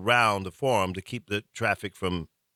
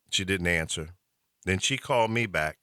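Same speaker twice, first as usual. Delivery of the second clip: ordinary delivery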